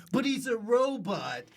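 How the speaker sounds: high, over-keen client explaining; mimicking a gushing voiceover director; mock client brief. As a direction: pitch the contradictions up high and emphatic.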